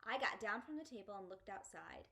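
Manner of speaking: plain reading voice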